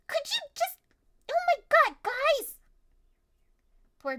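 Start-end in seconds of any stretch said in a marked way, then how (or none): 0.1-2.5 exasperated high cry. Retold or imitated. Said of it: "And I'm like".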